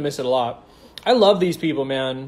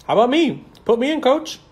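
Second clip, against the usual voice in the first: excited pleading; 8 semitones higher, 4 dB louder than usual. Real, not acted. Begging to join the tour.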